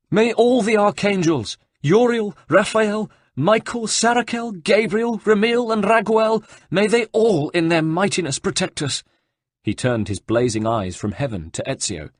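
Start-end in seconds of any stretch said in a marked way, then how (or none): 0.1-9.0 high, loud, terrified invocation of angels; horrified cry. Acted. Abbot cries to the archangels in horror, high and loud. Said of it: "drew back in horror and cried".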